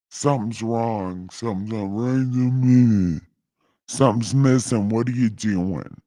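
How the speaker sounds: God sensing something is off, lightly voiced. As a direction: muse on it like a suspicious God. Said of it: "God voice".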